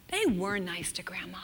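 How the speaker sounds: a tender whispered emphasis. Real, not acted. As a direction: drop to a whisper to land it.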